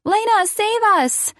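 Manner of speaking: very high, squealed plea; little boy's scream. A narrator's reading. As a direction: cry out like a kid being captured in play. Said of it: "John screamed".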